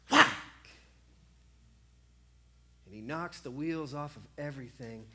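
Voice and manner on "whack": shouted whack sound; loud mock whack. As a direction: smack the word like a staff strike. Sound of staff hitting.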